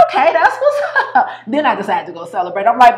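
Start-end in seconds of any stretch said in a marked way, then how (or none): 0.1-1.3 high, excited, laughing okay; hyped okay. Retold or imitated. Told luxury investment, she squeals with delight. Excited realization, quoted self.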